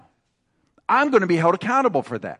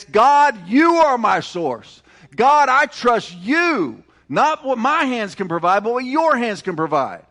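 high, slow, pleading prayer to God; heartfelt prayer; 5 semitones higher, 3 dB louder than usual. Pretend. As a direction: pray it out slowly, voice lifted. Voicing a prayer of trust.

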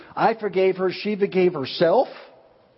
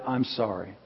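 much softer and lower, a deep-breathed sincere sorry; heartfelt apology; 6 semitones lower, 7 dB quieter than usual. Retold or imitated. Shrek apologizing from the heart, soft and sincere. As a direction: let it come slowly from the heart. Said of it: "he just breathed deep and said".

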